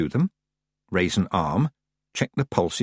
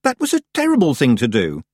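higher-pitched telling-off; stern; 5 semitones higher, 4 dB louder than usual. A narrator's reading. Tiffany scolding the witch for faking death, stern. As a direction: scold her reprovingly. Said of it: "said Tiffany sternly".

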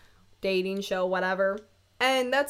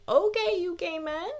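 sassy approval; 6 semitones higher, 3 dB louder than usual. Retold or imitated. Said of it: "I was like".

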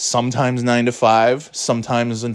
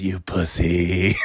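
taunting; 6 semitones lower, 3 dB quieter, much slower than usual. Retold or imitated. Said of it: "He goes".

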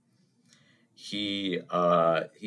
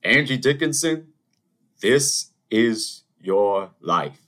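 louder, very slow grand announcement; milk the big reveal like a TV host; game show host reveal; 3 semitones higher, 6 dB louder, slower than usual. Retold or imitated. Pat Sajak springing the show on Angie, grand and slow.

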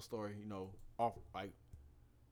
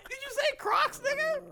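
much higher, through laughter; laughing disbelief; 4 dB louder than usual. Retold or imitated. Quoting Devin dying of laughter, high and laughing. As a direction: squeal it out while cracking up. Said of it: "Devin was like".

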